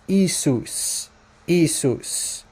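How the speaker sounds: "Isus", drawn out, ending on a clear s; demonstrated pronunciation. Shown as a demonstration. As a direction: slowly say "Isus" with an s in the middle. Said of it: "the Muslims said that it's pronounced".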